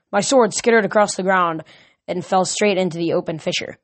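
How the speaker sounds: ordinary delivery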